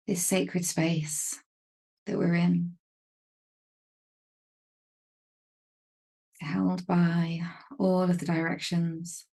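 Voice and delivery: calm, unhurried meditation voice with long pauses; even, measured delivery, leaving space to breathe; guided meditation. Real, not acted. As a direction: gently guide them into stillness, phrase by phrase.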